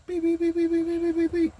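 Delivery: very high steady beep beep; imitated alarm beeps. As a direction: chirp it like camera alarms going off.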